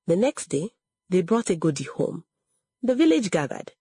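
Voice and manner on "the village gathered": usual speaking voice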